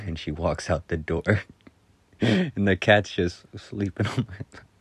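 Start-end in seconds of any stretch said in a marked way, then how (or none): none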